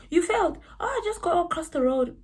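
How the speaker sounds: high-pitched butting-in voice; nosy security guard. Retold or imitated.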